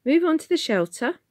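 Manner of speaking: high, loud brisk warden order. A narrator's reading. Warden ordering people to the shelter, loud and brisk. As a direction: herd the crowd to safety. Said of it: "a loud, brisk voice".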